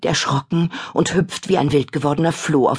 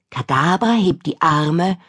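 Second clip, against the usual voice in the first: usual speaking voice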